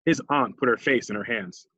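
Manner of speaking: ordinary delivery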